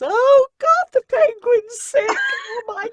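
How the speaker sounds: loud, high mock wail; hammed-up crying. Narrator re-enacts crying over her book's sick penguin, hammed-up wailing. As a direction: wail it slowly in comic, exaggerated sobs. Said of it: "I couldn't stop crying".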